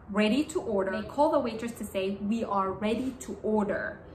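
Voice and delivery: ordinary talk, nothing marked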